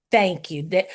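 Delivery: ordinary delivery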